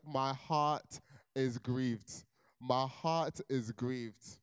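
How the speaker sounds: hammed-up slow lament; mock grief. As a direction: wail it slowly like a melodramatic mourner. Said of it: "That was the language I was using".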